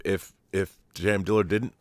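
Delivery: ordinary delivery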